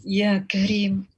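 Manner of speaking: measured chanted supplication with long pleading pauses; leading a prayer. Acted.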